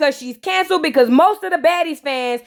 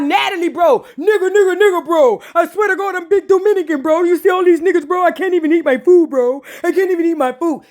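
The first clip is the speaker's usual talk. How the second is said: mock [name]. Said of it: "talking about".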